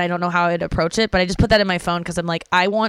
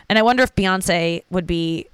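ordinary talk

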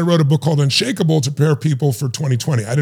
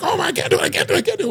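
high mock panic, repeating; panicked participant; 13 semitones higher, much faster than usual. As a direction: fake terror at the hot coals. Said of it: "And they're like".

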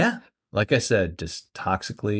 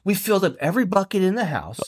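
put-on pleading drunk driver; 6 semitones higher, faster than usual.

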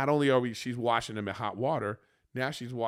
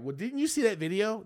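higher-pitched mock reproach; high, imitated complaint; imitating Rachel's nagging; 9 semitones higher than usual. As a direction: put on her pestering question, pitched up high. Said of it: "That's what she'll say".

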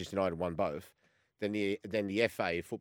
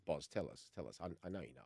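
hushed coaxing; 4 semitones lower, 13 dB quieter than usual. Retold or imitated. Senior players pressing him quietly.

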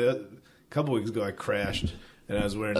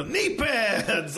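drawn-out, higher-pitched mock cheer; retold excitement; 10 semitones higher than usual. Falling rider hailing his knee pads, mock excited. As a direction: stretch it out in gleeful celebration. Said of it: "just excited they were doing their job".

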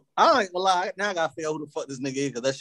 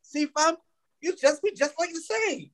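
high, drawn-out mock UK accent; 13 semitones higher, slower than usual. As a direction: mimic a UK accent, high and slow. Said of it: "Baltimore and, uh, in the U K sound alike".

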